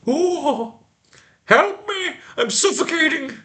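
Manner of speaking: high hysterical falsetto wail; shrieking falsetto. Acted. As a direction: shriek it like a panicking lady. Hysterical woman in a cave-in.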